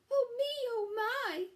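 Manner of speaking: high, loud and panicky; frightened squeal. Acted. Scared Square at door.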